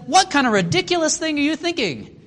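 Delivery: high-pitched, incredulous acted question; incredulous rebuke. Jesus challenging the Pharisees' thinking, high incredulous rebuke. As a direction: pitch it way up in disbelief.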